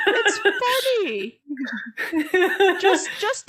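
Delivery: words squeezed out through laughter, slow; can barely say it for laughing. Real, not acted. Reassuring her friend it was funny, laughing hard.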